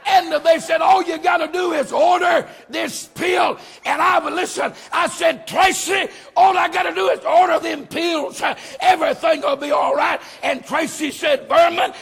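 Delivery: fired-up Southern pulpit shout; full-force preaching. Real, not acted. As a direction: preach it out to the whole church.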